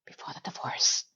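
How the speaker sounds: dry whisper, almost silent; a sly whispered afterthought; whispered aside. Real, not acted. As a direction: breathe it out as a rueful aside. Wry aside after happy memory.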